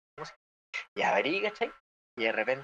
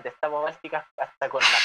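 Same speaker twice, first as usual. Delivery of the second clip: ordinary talk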